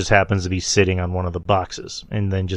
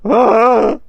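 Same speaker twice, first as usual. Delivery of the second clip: high-pitched mock scream; imitated frightened yelp; 12 semitones higher, 5 dB louder than usual. Podcaster imitating Paul fleeing Solo, high mock yelp. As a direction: yelp like a spooked manager. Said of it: "you just hear Paul go".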